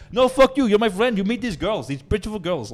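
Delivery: loud, high mock-Russian shout; yelling Russian guy. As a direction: call them over like a pushy Russian. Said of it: "He's, like, yelling at them".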